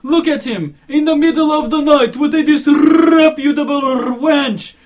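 high, very loud, slow roar with rolled r's; frenzied exclaiming. Acted. Old commissioner exclaims to the gentlemen, roaring in outrage. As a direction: bellow it, drawing words out. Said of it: "the police commissioner kept exclaiming".

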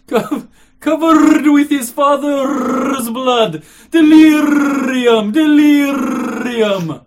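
high, very loud, slow roar with rolled r's; frenzied exclaiming. Acted. Old commissioner exclaims to the gentlemen, roaring in outrage. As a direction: bellow it, drawing words out. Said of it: "the police commissioner kept exclaiming".

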